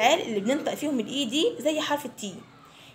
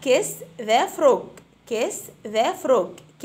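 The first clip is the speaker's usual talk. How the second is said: put-on very slow, clear drill phrase for children to repeat; slow model phrase; 4 dB louder, much slower than usual. Shown as a demonstration. Teacher to fifth graders, model phrase very slowly. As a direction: stretch each word out for the class. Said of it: "Repeat after me".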